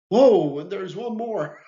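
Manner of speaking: mock surprise. Real, not acted. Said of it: "exclaims at another rule".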